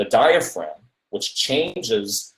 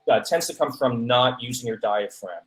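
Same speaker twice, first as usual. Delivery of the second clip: usual speaking voice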